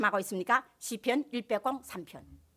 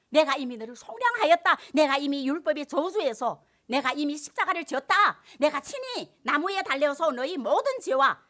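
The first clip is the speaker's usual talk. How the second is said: louder, forceful proclamation; preaching God's words; 4 semitones higher, 8 dB louder than usual. Acted. Pastor proclaiming God's redemption to the congregation, forcefully. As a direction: thunder each promise out.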